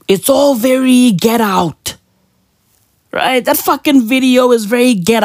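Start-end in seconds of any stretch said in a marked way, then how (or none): none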